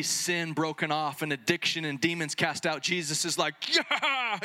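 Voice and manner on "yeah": fired-up yeah. Retold or imitated. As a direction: cheer it like a win. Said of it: "he's fired up".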